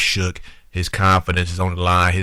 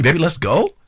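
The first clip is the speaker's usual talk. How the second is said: coaxing, higher-pitched, lifting at the end; higher mock-coaxing plea; mock sweet-talking; 7 semitones higher, 3 dB louder than usual. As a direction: imitate someone sweet-talking their partner. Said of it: "sweet talking me".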